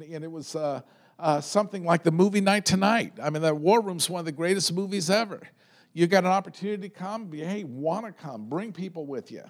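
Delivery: ordinary talk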